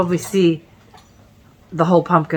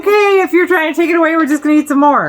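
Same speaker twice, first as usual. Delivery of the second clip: dog voice; 10 semitones higher, 4 dB louder, much faster than usual. Retold or imitated. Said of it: "they're like".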